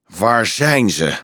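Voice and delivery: put-on slow, growled demand from a fierce girl; belligerent, drawn-out growling; combative growl. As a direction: growl it slowly, ready for a fight. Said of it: "gromde een strijdlustig meisje".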